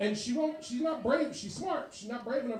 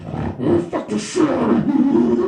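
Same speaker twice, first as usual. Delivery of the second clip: louder, slow demonic growl; demonic roar; 6 semitones higher, 18 dB louder, slower than usual. Shown as a demonstration. Comic demonstrating a woman's real orgasm as a demon roar. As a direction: bellow like a possessed demon. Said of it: "A real orgasm is always demonic".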